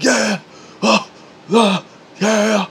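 high hyped grunts; lifting yell. Retold or imitated. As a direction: grunt and yell through the rep. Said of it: "he starts screaming".

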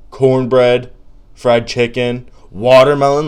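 loud, eager food list; loud excited food roll call. Real, not acted. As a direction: rave about each dish. Excited listing of good food.

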